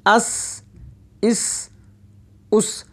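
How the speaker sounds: "अस", "इस", "उस" ending in a thin whistle-like s; whistling seen. Shown as a demonstration. Teacher demonstrates seen, whistling the s in "अस", "इस", "उस". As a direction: let the final s of "अस", "इस", "उस" whistle. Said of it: "पहले सीटीसी आवाज़ आएगी".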